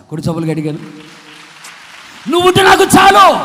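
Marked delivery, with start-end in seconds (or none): 2.3-3.4 high, full-voiced preaching cry; shouted devotion. Real, not acted. Preacher voicing a believer's prayer to the Lord, shouted devotion. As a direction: proclaim it loudly to the whole hall. Rousing cry after applause call.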